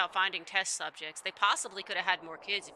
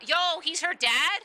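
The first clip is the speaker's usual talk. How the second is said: loud, high, drawn-out disbelief; stunned, loud outburst; shocked; 7 semitones higher, 6 dB louder, slower than usual. Real, not acted. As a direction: exclaim slowly in real shock, pitch shooting up. Reacts to a story reveal.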